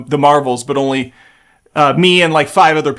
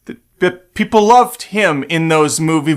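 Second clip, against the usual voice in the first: normal talking voice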